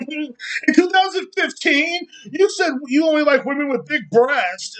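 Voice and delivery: high, slow mock whiny voice; mocking accuser. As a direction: drag it out in a squeaky sneer. Mocking the people who cancel him.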